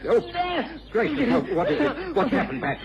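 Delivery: sudden high alarmed cry; startled, rising fast in alarm. Acted. Watson startled by the boy's frantic state, high and alarmed.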